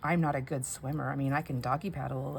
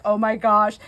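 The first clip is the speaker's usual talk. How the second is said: alarmed oh my gosh; 4 semitones higher, 9 dB louder than usual. Retold or imitated. Retold panic in kayak.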